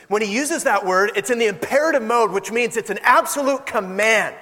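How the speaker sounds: usual speaking voice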